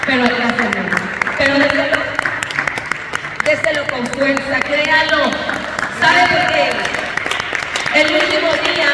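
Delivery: loud, rising preacher's call to applaud; preaching at full force. Acted. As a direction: rouse the congregation, shout it out. Urges congregation to applaud.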